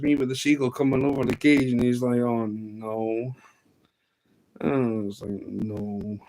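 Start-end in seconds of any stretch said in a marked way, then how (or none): 2.2-3.3 drawn-out, softer letdown; deflated groan. Retold or imitated. Acts out friend's deflated reaction. 5.5-6.2 soft, drawn-out groan; quiet mock despair; deflated dismay. Acts out own deflated reaction.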